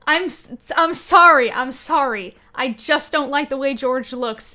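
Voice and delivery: ordinary delivery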